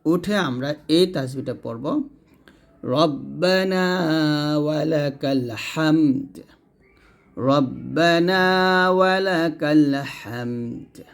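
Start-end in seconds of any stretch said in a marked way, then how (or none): 2.8-11.0 রব্বানা ওয়ালাকাল হামদ very slow, clear দ at the end; slow model recitation. Shown as a demonstration. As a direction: recite slowly and sound the দ in হামদ. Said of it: "এই দালটা এখানে উচ্চারণ আসবে". (ধীরে, হামদ-এর দ স্পষ্ট)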